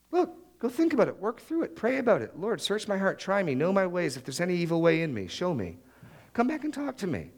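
high, mock-sweet brush-off; snarky reply. Pastor answering a fake apology, high and snarky. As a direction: say it high and a bit snide, sending them away. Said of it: "if I'm snarky".

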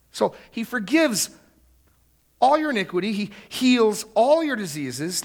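high, forceful proclamation; emphatic preaching. Shown as a demonstration. Preacher as David recounting benefits to his soul, emphatic. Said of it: "The Hebrew is even more emphatic".

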